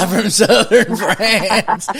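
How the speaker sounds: high, very slow southern twang through laughter; mock southern accent. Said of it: "with this southern accent".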